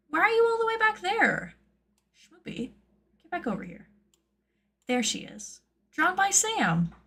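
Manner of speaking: put-on very high cooing baby talk; squeaky, doting pet-owner voice. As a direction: coax a little pet back, high and doting. Coaxes mascot back on screen.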